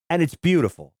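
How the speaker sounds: ordinary talk, nothing marked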